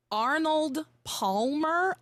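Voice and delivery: "Arnold Palmer" slowly and carefully, each syllable drawn out; slow deliberate "Arnold Palmer". Shown as a demonstration. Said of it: "I could never say it fast".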